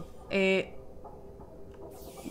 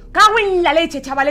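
frustrated curse; 4 semitones higher, 5 dB louder than usual. Real, not acted. Frustrated outburst.

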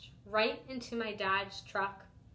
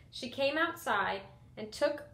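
ordinary delivery, nothing marked

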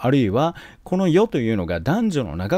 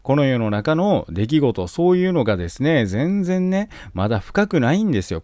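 ordinary talk

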